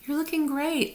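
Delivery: high, hushed compliment; admiring friend. Retold or imitated. People noticing her weight loss, hushed high compliment. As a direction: breathe it like a delighted aside. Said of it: "people are starting to notice and say".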